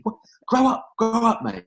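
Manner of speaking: mock Clive brushing him off; dismissive Clive.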